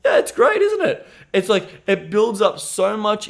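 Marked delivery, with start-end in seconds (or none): 0.0-1.1 very high, louder mock-chirpy mentor voice; high sing-song take-off of the mentor; mentor's cheerful quip. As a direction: chirp it back like it's obvious. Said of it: "He goes".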